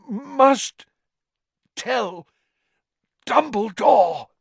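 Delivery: high, broken words pushed out; each word costs a terrible effort; strained effort. Acted. Crazed Crouch begs Harry to see Dumbledore, straining. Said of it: "Every word ... terrible effort".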